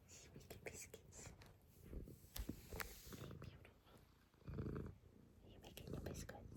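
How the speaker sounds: hushed whisper so as not to disturb; whisper to the cat. Real, not acted. Whispering to her purring cat, soft hush. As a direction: breathe it to the kneading cat.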